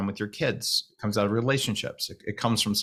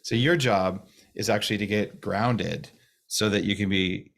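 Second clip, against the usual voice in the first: normal talking voice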